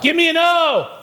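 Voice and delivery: high, slow, sinking cheer chant; gloomy cheer call. Pretend. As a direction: lead a hopeless cheer, high and drawn out. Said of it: "pessimistic cheerleaders".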